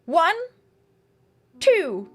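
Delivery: lightly put-on British accent, emphatic count. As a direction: declaim it with a touch of British accent. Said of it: "I said threeser in a British accent".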